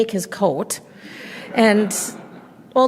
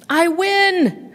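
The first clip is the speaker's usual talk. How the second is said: high, gleeful "I win!"; triumphant cheer; 10 semitones higher than usual. Acted. Grandma wins dreidel, squealing with glee. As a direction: jubilant squeal of victory.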